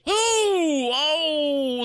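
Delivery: high, loud wincing ooh; pained wince. Acted. Host winces at kick.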